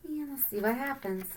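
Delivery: usual speaking voice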